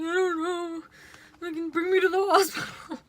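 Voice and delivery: high, hammed-up begging; mock panicked plea. Stoned kid begging dad for the hospital, mock panic.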